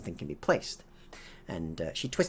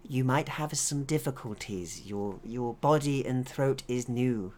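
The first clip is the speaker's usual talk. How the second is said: lightly voiced, higher-pitched scientist; gently explain, slowing down; 6 semitones higher, 3 dB louder, slower than usual. Scientist warns synthetic of its new body, higher and careful.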